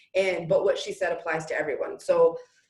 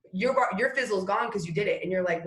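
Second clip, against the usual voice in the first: ordinary delivery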